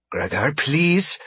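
slow, high plea; pleading. A narrator's reading. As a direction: beg the captor gently but urgently. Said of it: "Marie pleaded".

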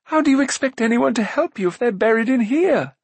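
high-pitched, eager call; high princely call. A narrator's reading. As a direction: call out in lofty agreement. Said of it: "called Prince Rune".

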